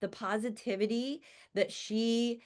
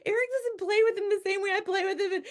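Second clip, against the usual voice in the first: high, fast whiny wail; hammed-up tearful plea, much higher; childlike mock sobbing complaint, higher and fast; mock crying; 13 semitones higher, 4 dB louder, faster than usual. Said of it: "I started crying and".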